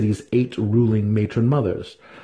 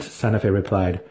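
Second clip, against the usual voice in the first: usual speaking voice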